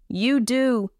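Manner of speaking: helpless, yielding gasp; gasped surrender. A narrator's reading. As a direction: gasp it out, giving in completely. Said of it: "he gasped".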